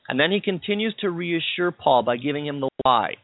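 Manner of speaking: normal talking voice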